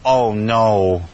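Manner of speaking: low, drawn-out "oh no"; sinking, mildly acted dismay; retold dismay. Retold dismay at losing his bag.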